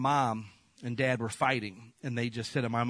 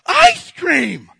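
far higher and much louder, shrieked; over-the-top excitement; 15 dB louder, much slower than usual. Retold or imitated. Little boy hearing coach promise ice cream, shrieking. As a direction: squeal like an ecstatic little boy. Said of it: "of course I'm like".

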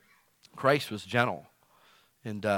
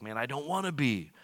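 exasperated; 7 semitones higher than usual. Retold or imitated. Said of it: "I'm like".

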